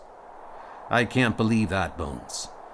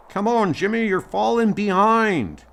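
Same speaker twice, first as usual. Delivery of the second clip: high, teasing call to a climbing partner; 9 semitones higher, 4 dB louder than usual. Acted. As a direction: call back to a friend lagging behind. Friend calling out during remembered climb.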